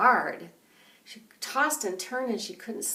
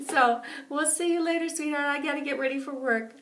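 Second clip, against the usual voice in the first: ordinary delivery, nothing marked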